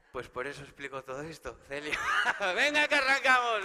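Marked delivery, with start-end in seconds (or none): 2.0-2.5 loud real laugh; short hearty laugh; burst of laughter. Real, not acted. Laughs at own joke. 2.5-3.6 higher, louder fake kickoff shout; hammed-up start-of-show call; mock hype. Stand-up pumping up audience.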